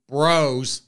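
usual speaking voice

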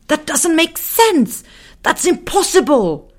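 very high, loud, slow little man protesting; shocked protest. Acted. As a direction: splutter in disbelief. Muffin protests.

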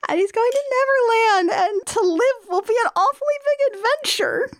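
high, teary, overcome voice; tearful gushing. Pretend. Fan overcome by the film's last line, high and tearful. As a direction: gush as if about to cry.

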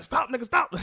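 loud high yell to stop; retold shout. Friend not yet in car screaming to stop, loud high yell.

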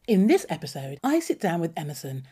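usual speaking voice

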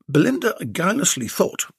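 usual speaking voice

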